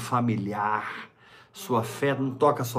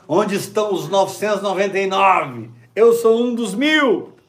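excited boast; 9 semitones higher, 9 dB louder than usual. Pretend. Excited boasting believer voiced.

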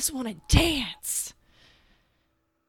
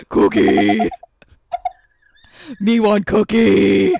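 Cookie Monster; 10 dB louder than usual. Acted. Cohost doing Cookie Monster for laughs, loud monster voice. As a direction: bellow it like a hungry Muppet. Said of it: "sound like Cookie Monster".

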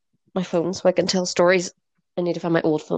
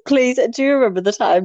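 ordinary talk

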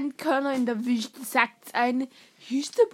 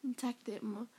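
whispering; 3 semitones lower, 8 dB quieter, faster than usual. Retold or imitated. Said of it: "whispered gossip".

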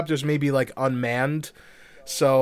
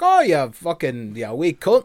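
put-on Irish accent; 3 semitones higher, 5 dB louder than usual. Grumpy uncle Cait Sith grumbling, in an Irish accent. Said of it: "have an Irish accent for no reason".

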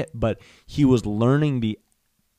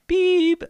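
loud, high-pitched beep; imitated elevator beep; 7 dB louder than usual. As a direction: cut in like an elevator signal.

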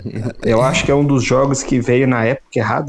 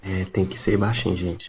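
quiet at night; 6 semitones lower, 8 dB quieter than usual. Real, not acted. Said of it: "tá falando baixinho pra não acordar a cria". (falando baixinho pra não acordar o bebê)

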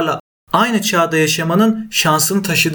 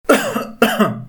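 sharp high cough; 7 semitones higher than usual. Real, not acted. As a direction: clear the throat with a cough. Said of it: "öksürüp duruyorum".

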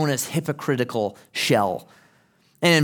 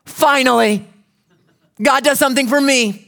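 high, loud burst of relief; a sulking prophet suddenly thrilled, high and loud; excited Jonah; 12 semitones higher, 8 dB louder than usual. Retold or imitated. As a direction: crow it like a man finally getting his way. Jonah's thought voiced by preacher.

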